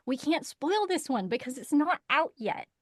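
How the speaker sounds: normal talking voice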